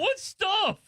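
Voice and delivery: surprised. Real, not acted. Friend says three shirts.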